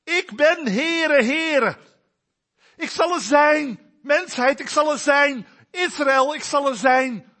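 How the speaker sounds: loud, high proclamation as God; God proclaiming. Acted. God proclaiming his name to Israel, loud and high. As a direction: ring it out as God over humanity.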